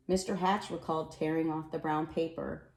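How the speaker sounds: ordinary delivery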